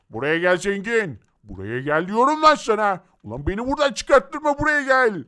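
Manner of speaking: angry voiced monster calling Zengin over; menacing mob voice. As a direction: threaten like a furious villain. Voices orange Deadpool threatening Zengin. (turuncu Deadpool'un öfkeli tehditkâr sesi)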